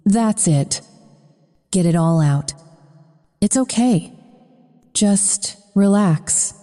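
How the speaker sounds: slow, gentle calming voice; soothing. Acted. Man soothing the half-drowned woman he rescued, slow and gentle. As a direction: ease her through the coughing, slowly. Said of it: "His voice is soothing".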